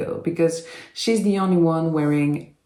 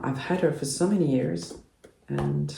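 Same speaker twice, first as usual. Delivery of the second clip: normal talking voice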